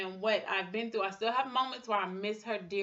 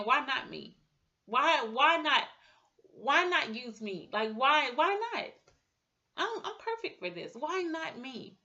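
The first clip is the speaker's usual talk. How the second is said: higher, rising defiant why not; higher voice, from lament to defiant faith; defiant resolve; 5 semitones higher than usual. Retold or imitated. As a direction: turn pain into a bold challenge. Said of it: "just started saying".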